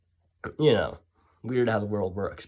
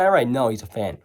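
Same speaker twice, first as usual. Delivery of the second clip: ordinary talk, nothing marked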